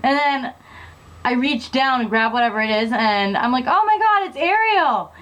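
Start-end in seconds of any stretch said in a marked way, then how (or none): 3.7-5.1 high-pitched mock exclamation; retold excited cry. Girl pulling the lost doll from the pool, retold excited cry. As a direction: act out the big discovery, voice swooping high.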